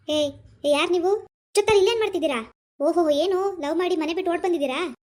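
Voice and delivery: bellowed, accusing interrogation; loud police challenge. Acted. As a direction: accost the couple like a suspicious policeman. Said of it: "ಯಾಕ್ ಜೋರಾಗ್ ಮಾತಾಡ್ತೀರಾ?".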